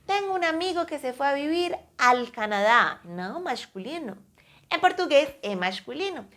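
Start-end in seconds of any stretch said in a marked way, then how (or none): 0.1-3.0 a quick example sentence said fast; Spanish sentence, fast. Shown as a demonstration. Teacher quoting a Spanish example sentence to her students, said fast. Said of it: "como vocês diriam em espanhol".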